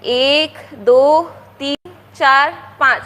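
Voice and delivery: slow, drawn-out counting; slow counting aloud. Shown as a demonstration. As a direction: spell out each number for kids. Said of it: "देखो कौन सी संख्या पहले आ रही है". (धीरे-धीरे गिनती)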